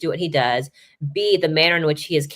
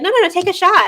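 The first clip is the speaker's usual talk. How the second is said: high, loud insistent urging; won't take no for an answer; insisting teammates; 6 dB louder than usual. Retold or imitated. Players pressing the reporter to shoot, high loud insistence.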